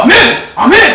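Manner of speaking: high, loud double amen; fervent amen shout. Retold or imitated. Preacher mimicking bold teens answering the sermon, loud fervent shouts. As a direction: shout amen with full conviction.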